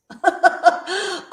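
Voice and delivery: high, quick chuckling burst; real giggle. Real, not acted. Streamer amused by the chat, real giggle. Laughs after reading comment.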